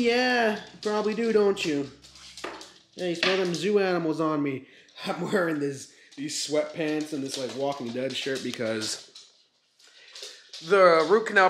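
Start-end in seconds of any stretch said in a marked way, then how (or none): none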